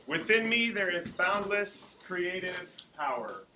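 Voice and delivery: slow, loud chanted affirmation. Acted. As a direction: lead the group slowly. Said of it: "leading the students in affirmations".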